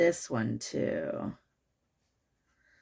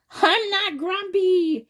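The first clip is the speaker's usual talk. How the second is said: much higher, slow, louder insistence; grumpy denial; 10 semitones higher, 4 dB louder, slower than usual. Acted. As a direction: deny it stubbornly, like a sulky kid.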